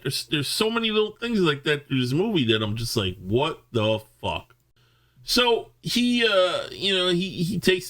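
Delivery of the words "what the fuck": slow, drawn-out disbelief; exasperated. Acted. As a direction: stretch each word out in exasperation. Exasperated reaction to the film.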